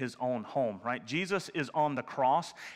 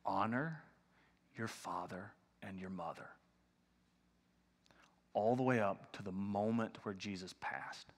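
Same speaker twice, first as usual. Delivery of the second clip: hushed appeal; 7 semitones lower, 9 dB quieter than usual. Real, not acted. Pastor hushes to urge the church to honor parents, near whisper. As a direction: drop to a near whisper, softer, lower.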